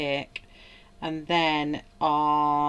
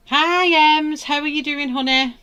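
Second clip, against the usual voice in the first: much higher and louder, cooing hello; sing-song greeting; 10 semitones higher, 12 dB louder than usual. Real, not acted. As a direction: greet her like a dear friend. Greets a live chat viewer.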